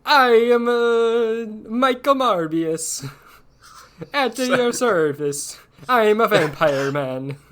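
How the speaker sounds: high, drawn-out, hammed-up Italian accent; terrible Italian accent. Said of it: "somehow the worst Italian accent".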